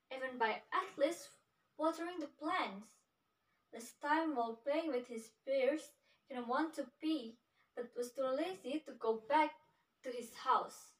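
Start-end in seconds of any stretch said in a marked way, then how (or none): none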